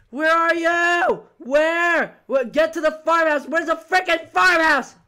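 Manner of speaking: loud, high frantic calling; desperate shouting. Real, not acted. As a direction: yell for a lost teammate. Searching desperately for last teammate.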